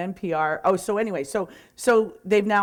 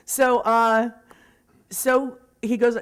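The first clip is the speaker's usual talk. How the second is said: ordinary talk, nothing marked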